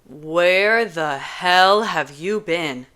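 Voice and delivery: slow, loud, exasperated Kenji; dragged-out angry question; annoyed demand. Acted. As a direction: call her out, fed up. Kenji angry at Juliet's lateness.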